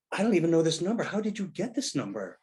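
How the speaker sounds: high-pitched, incredulous questioning; mock-flustered on the phone, voice pushed up high; acted-out bafflement. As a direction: demand an explanation in comic confusion. Retells baffled call from his wife.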